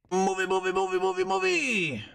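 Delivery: high chanting call; singsong calling. Pretend. Calling out for the movie.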